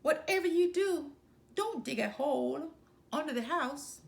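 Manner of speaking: hammed-up panic, pitched high; mock pleading, high and loud. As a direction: cry out as if begging, laying the trick. Pig tricks wolf with mock plea.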